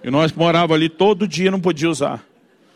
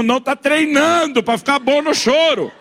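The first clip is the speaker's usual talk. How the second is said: high, drawn-out exasperated shout; sarcastic yell; 9 semitones higher, 3 dB louder, slower than usual. Acted. Father wanting to scream at silly question, sarcastic yell. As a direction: cry out the sarcasm to heaven. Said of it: "Eu quero gritar pra Deus".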